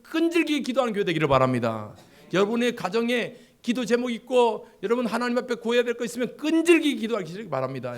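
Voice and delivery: high, loud urging to pray; fervent preaching. Acted. Pastor urging the congregation to keep praying. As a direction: exhort the church at full force.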